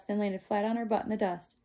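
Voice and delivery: plain reading voice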